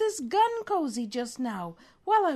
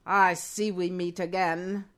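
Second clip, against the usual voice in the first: put-on low villain, faint German accent; attempted German villain; 8 semitones lower, slower than usual. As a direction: gloat as a German mastermind, pitched down. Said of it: "Are you German now?".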